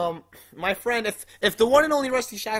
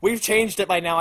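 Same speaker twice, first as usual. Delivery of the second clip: ordinary delivery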